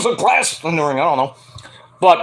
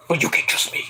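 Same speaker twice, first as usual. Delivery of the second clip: unvoiced, sly whisper; 4 semitones lower, 7 dB quieter, faster than usual. Jake whispering to Archer to trust him. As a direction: whisper it like a con man. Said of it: "Jake's like".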